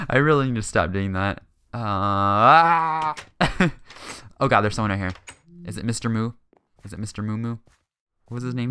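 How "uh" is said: drawn-out uh swelling into a yell; exasperated uh. Real, not acted. Gamer catches his filler again, yelling it out in exasperation. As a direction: let the uh grow into a loud groan.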